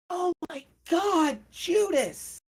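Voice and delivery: very high terrified cry; horrified scream. Acted. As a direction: shriek his name in terror. Girl screams at blood.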